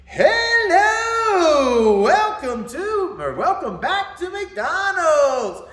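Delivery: high, loud, slow, stretched-out greeting; a greeter who loves his job, hammed up; overeager greeter. Imitating the greeter's booming drive-thru welcome, high and loud. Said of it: "I could just feel the energy through the thing".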